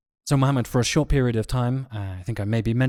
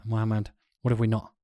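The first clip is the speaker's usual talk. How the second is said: ordinary talk, nothing marked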